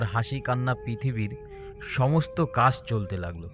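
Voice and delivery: plain reading voice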